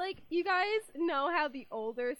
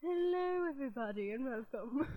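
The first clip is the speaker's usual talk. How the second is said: put-on voice. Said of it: "Let's talk like this the whole show".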